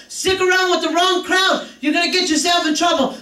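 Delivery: a hint of a stern parent warning. Mother warning her kids about bad company, lightly stern. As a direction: lay down the law to the kids. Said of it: "I would tell them over and over".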